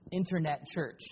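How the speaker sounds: normal talking voice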